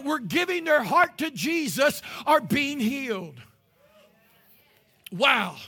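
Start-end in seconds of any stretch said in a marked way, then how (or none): none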